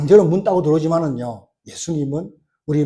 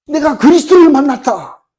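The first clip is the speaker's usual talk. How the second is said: much higher, louder proclamation; excited shout; 10 semitones higher, 10 dB louder than usual. Retold or imitated. Woman telling villagers she met Christ, imitated excited shout. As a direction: cry it out to the whole village. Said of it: "막 고함 질러요".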